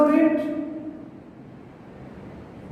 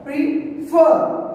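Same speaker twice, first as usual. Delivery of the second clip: "prefer" split in two, FER higher and stronger; stress on second syllable; 5 semitones higher than usual. Shown as a demonstration. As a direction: demonstrate "prefer" as pre... FER. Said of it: "second syllable".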